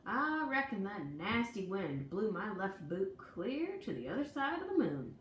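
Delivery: ordinary delivery, nothing marked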